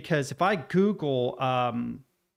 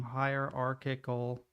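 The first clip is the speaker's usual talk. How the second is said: spelling it out; 3 semitones lower, 7 dB quieter, much slower than usual. Real, not acted. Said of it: "I feel like I spelled that wrong".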